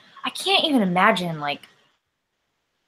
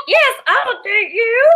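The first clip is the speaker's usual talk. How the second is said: much higher, slow puppet voice; 5 dB louder, slower than usual. Retold or imitated. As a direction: squeak it out like a shy puppet. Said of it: "And the puppet goes".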